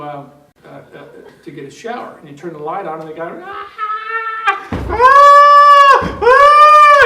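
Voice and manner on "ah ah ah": long, high, full-volume scream; acted terrified shriek; panicked scream. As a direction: scream in mock terror. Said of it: "he was just panicking".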